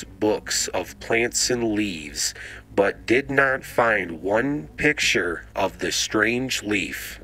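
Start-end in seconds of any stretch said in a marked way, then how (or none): none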